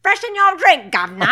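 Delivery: very high, very loud mock-Cockney British; comic British screech. Cartoon woman offering drinks, shrieked British accent. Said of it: "she's wearing a British flag".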